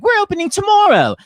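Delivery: high, loud mock announcement; overeager announcement. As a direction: crow it out as the overconfident West End. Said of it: "The West End is always like".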